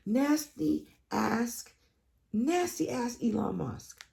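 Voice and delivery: high, slow drawn-out disgust. Real, not acted. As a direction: stretch out nasty with contempt.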